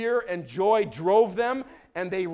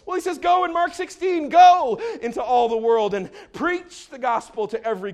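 high, loud preacher's shout easing off; impassioned preaching; 4 semitones higher, 6 dB louder than usual. Real, not acted. Preacher sending believers into all the world, impassioned shout. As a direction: launch the command high and loud.

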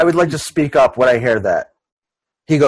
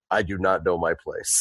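low, soft, deadpan awkward adult; awkward outsider; 7 semitones lower, 9 dB quieter than usual. Acted. Awkward adult at a child's sleepover, low and deadpan. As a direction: mumble it low like you don't belong. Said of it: "you're just like".

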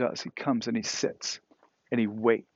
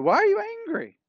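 "Why are you angry?" in a much higher, louder voice; God's question; 5 dB louder, faster than usual. Retold or imitated. Preacher voicing God challenging sulking Jonah, high and loud. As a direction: leap way up on the question, calling out. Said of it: "and God says to Jonah".

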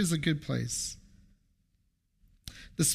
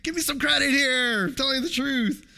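exasperated dad protest; 8 semitones higher than usual. Retold or imitated. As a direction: plead for credit like a wronged dad. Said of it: "I thought".

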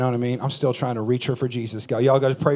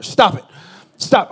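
higher, louder stop it; mock scolding shout; 6 semitones higher, 6 dB louder, slower than usual. Parent filming a video yells at the kids, scolding shout.

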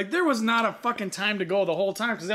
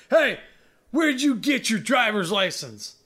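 higher, louder challenge, drawn out slowly; confrontational scooter rider; 3 semitones higher, 3 dB louder, slower than usual. Retold or imitated. Scooter rider confronts driver at his window, indignant call. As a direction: imitate an indignant rider calling out. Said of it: "he's like".

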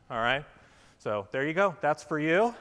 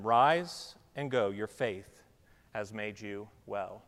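gentle blessing; 7 semitones lower, slower than usual. Acted. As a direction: give the blessing gently and slowly. Jesus speaks to healed man.

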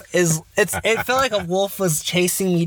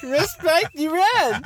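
laughing mock respect; 9 semitones higher, slower than usual. Parents laughing, retold.

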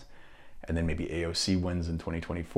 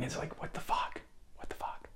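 disbelieving whisper; 12 dB quieter than usual. Real, not acted. Disbelief aside.

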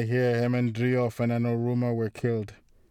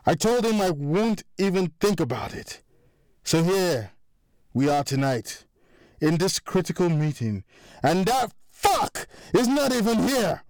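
rising anger; 6 semitones higher than usual. A narrator's reading. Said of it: "his voice getting louder".

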